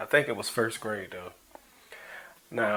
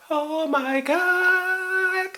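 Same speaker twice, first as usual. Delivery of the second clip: much higher, very slow; drawn-out high oh my god; 4 dB louder, much slower than usual. Real, not acted. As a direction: stretch it out in disbelief.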